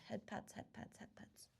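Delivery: soft whispered head pats; whispering. Real, not acted. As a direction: breathe it out as a gentle whisper. Sending head pats to viewers.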